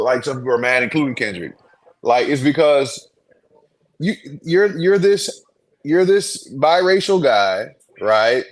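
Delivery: normal talking voice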